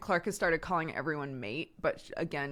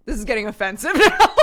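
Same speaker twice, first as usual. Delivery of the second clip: laughing quote; 6 semitones higher, 13 dB louder, faster than usual. Retold or imitated. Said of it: "Nancy was like".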